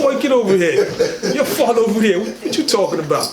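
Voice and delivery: high, laughing scold of a mother; laughing mock scolding. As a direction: call the boy over, half laughing. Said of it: "I said".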